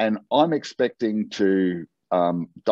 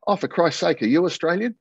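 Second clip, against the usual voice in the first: exasperated surprise; 9 semitones higher than usual. Retold or imitated. Caller equally surprised.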